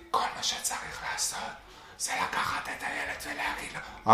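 whispered motherly advice on the phone; mocking whisper. Mother whispers advice to her son over speaker. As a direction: hush it like a secret. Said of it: "אבל למה בלחישות?".

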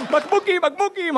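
put-on excited kid. Kid cheering bottles.

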